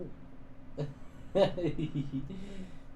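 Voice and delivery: screaming laugh. Real, not acted. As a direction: shriek with laughter. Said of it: "I'm screaming too".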